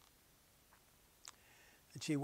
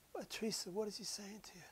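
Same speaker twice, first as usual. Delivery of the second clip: a softer, higher whispered plea; hushed, pleading whisper; 6 semitones higher, 3 dB quieter than usual. Retold or imitated. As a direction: lean in and beg her quietly. Husband asks softly.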